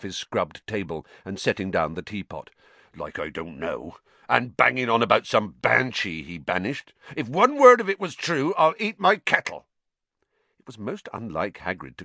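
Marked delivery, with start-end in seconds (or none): 2.9-9.7 builds to a loud scornful scoff; mock the fool's bragging; scoffing giant. A narrator's reading. Hagrid scoffing to the children about Lockhart, rising to loud scorn.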